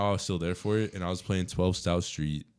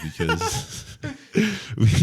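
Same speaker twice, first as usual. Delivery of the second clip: slow words breaking into laughter; laughing; 8 semitones higher, 5 dB louder, much slower than usual. Real, not acted. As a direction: laugh through it, barely getting words out. Laughing while recalling class.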